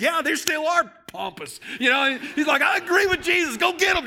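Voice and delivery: high, loud cheering shout; shouting excited agreement. Retold or imitated. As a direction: cheer him on like a fan. Preacher voicing Israel's excitement.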